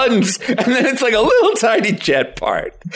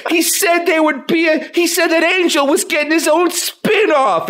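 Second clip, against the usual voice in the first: high-pitched, desperate insistence; frantic insisting witness; 8 semitones higher than usual. Acted. Witness telling people the frog talked, acted frantic insistence. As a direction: plead that you really saw it. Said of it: "And you'd be like".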